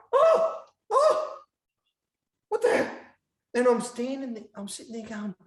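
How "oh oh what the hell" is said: high, loud, hammed-up shocked yelp; mock shocked cry. Reenacting the prank for the podcast host, hammed-up shocked cry. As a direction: play the fake horror as the blue spills. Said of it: "I go like this".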